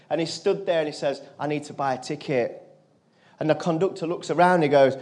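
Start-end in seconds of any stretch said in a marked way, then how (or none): 1.4-2.7 soft, low, emotional retelling. Real, not acted. Retelling a kid who had nothing, softly emotional. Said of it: "it makes me emotional saying it".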